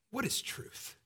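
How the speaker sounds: scornful whispered question; cynical. Retold or imitated. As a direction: throw it away with a sneer. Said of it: "And so cynically, Pilate responds".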